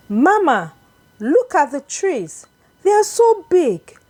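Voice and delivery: put-on a small child pointing in wonder; lightly voiced excited child; child exclaiming. A narrator's reading. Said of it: "the youngest exclaimed".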